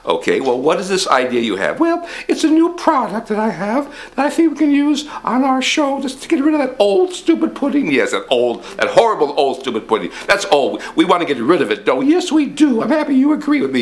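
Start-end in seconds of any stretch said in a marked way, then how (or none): 1.8-7.9 higher pompous rich man. Acted. 12.1-13.8 higher pompous rich man. Acted.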